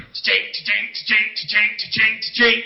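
rhythmic ratcheting chain noise; imitated coaster clanking. Preacher mimics the car going up the first hill, clanking. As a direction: clank evenly like a car climbing.

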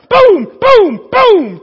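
loud, high, punched booms; booming shots in a row; three gunshot booms. Acted. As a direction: fire off each boom like a shot.

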